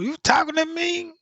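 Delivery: very high disbelieving question; can't believe the phone spoke; incredulous. Retold or imitated. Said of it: "My phone?".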